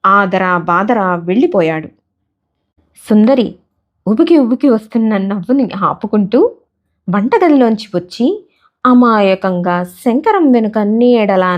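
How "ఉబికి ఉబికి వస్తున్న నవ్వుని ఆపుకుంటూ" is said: plain reading voice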